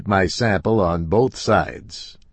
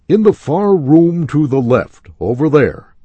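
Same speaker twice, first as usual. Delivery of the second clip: higher and louder clerk voice; calling clerk; 8 semitones higher, 8 dB louder than usual. A narrator's reading. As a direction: call the directions out across the store.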